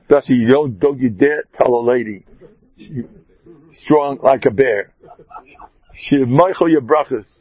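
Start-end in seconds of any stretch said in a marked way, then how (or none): none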